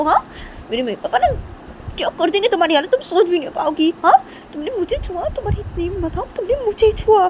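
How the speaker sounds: high-pitched, threatening rant; indignant Shanaya. Acted. Shanaya threatening Tisha with her parents, angry and indignant. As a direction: scold and threaten in a high, worked-up voice.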